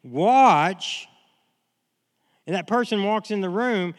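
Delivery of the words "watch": louder, firm single-word command; a sharp call to watch. Shown as a demonstration. Preacher voices the scripture's command to the congregation, firm and loud. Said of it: "it's saying".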